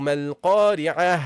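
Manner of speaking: "Mal-Qari'ah" recited slow and low, stopping on h; pausal ha ending. Shown as a demonstration. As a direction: finish the verse on a long "Mal-Qari'ah" with final h. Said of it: "Like this. You have to pronounce it".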